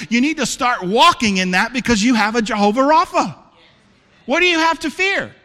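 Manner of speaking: high, loud, fervent sermon preaching; preaching at full force. Acted. Pastor challenging his church family, preaching at full force. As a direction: drive it home to the congregation.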